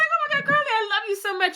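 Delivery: mock starstruck gush, very high-pitched; high, excited fangirl voice; gushing fan. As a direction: imitate a squealing, overwhelmed fan. Starstruck fan meets her idol, retold.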